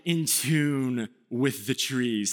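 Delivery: slow lofty mock caption; mock deep caption. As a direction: intone it like a cheesy nature post. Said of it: "or, like".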